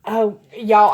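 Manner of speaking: loud, excited cry to the viewers; loud exclamation. Real, not acted. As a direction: burst out loudly at what you see. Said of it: "I know I'm being so loud".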